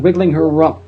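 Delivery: plain reading voice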